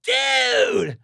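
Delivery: high, stretched-out cry. Retold or imitated. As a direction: imitate a rocker bowled over. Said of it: "He's like".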